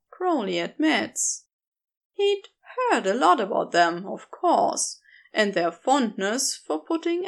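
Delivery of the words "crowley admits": ordinary delivery, nothing marked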